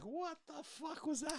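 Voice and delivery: very high, slow, softened mock squeal; baffled squeal. Actor mimics the baffled director, high-pitched disbelief.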